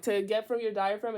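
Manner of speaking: ordinary delivery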